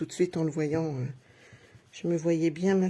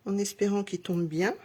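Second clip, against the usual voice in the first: normal talking voice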